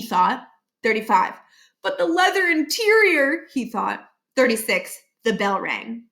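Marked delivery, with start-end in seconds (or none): 1.8-3.5 higher, torn inner voice; anguished principal. Acted. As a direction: plead with himself. Barkin torn.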